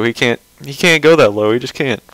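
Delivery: normal talking voice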